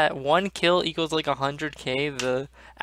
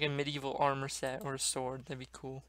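ordinary delivery

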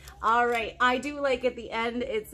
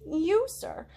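plain reading voice

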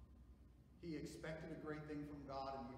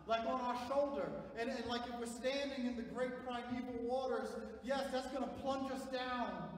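loud, high, slow proclamation; full-force preaching; 10 semitones higher, 11 dB louder than usual. Acted. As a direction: ring it out to the congregation.

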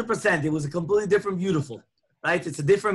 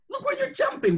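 incredulous parent. Retold or imitated. Parent scolding bouncing child.

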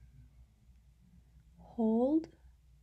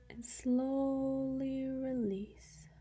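very slow, with "slowly" stretched long; drawn-out calming; much slower than usual. Real, not acted. Guide leading the listener to release a held breath, drawn out. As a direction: stretch it to the length of an exhale.

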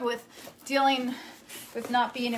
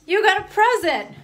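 higher, louder call to mom; raised voice announcing a present; 7 semitones higher, 6 dB louder than usual. Real, not acted. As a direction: call her over excitedly. Calling her mom to come over.

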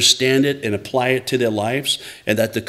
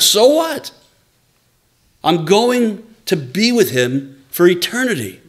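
fervent preaching, pitch climbing high; impassioned pulpit delivery building high; 6 semitones higher than usual. Real, not acted. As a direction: preach it out with rising fire. Preaching at full force, high pitch.

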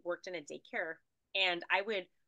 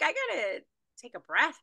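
much higher and louder, gasping for air; overwhelmed; 7 semitones higher, 9 dB louder than usual. Retold or imitated. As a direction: blurt it out as if the energy knocks the wind out of you. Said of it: "I'm like".

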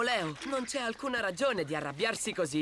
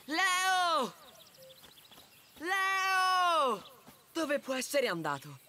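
high calls of his name; calling out; 7 semitones higher, much slower than usual. Acted. Friend calling for the missing Leo. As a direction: call out across the forest looking for him.

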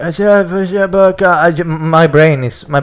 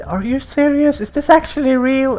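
disbelief; 11 semitones higher than usual. Real, not acted. Disbelief at car list.

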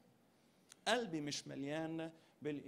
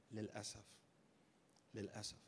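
soft lament; 6 semitones lower, 13 dB quieter than usual. Acted. Sad repeated lament.

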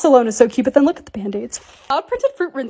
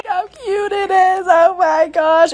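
slow, higher, drawn-out gush; gushing slowly; 5 semitones higher, much slower than usual. Real, not acted. Vlogger drooling over her new cup, slow and higher. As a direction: savor each word in awe.

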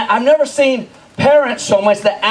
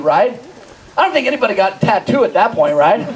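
ordinary talk

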